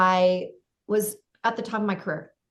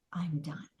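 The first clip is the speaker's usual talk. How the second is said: quiet inner voice; 8 dB quieter than usual. Retold or imitated. Inner voice heard in the driveway, quietly resigned. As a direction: murmur "I'm done" as the first hint. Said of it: "And I hear".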